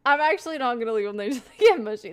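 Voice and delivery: loud, fast, mock-aggressive. Real, not acted. Telling chat about the mushy bananas, loud and aggressive. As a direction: fire it off hard and fast, laughing at yourself. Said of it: "That was very aggressive for no reason".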